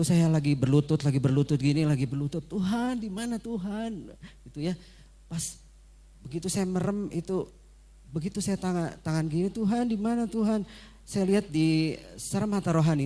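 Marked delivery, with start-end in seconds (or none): 2.4-4.1 high, broken cry, then fading to a sob; crying prayer. Retold or imitated. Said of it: "sambil nangis". 9.5-10.7 high, pleading call; crying prayer. Retold or imitated. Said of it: "sambil nangis".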